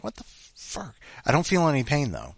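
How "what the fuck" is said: breathless whispered shock. Retold or imitated. Noticing his tooth coming loose, shocked whisper. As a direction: whisper it in disbelief. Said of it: "I'm like".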